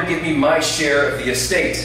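normal talking voice

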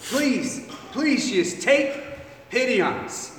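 father begging Jesus for pity; pleading. Acted. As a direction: beg as if it's the last chance.

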